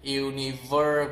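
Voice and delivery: UNIVER with a really strong R; American UNIVER, heavy R. Shown as a demonstration. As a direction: curl a strong ER at the end of UNIVER. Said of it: "with a really strong er".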